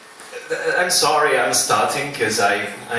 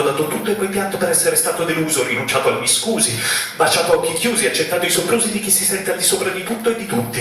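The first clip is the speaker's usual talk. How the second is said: building rhythmic recital, pitched up, dropping at the end; spoken-word poem; 5 semitones higher, faster than usual. Acted. Poet pouring out life's list to papà, building recital. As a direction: drive the rhyming list onward with rising intensity.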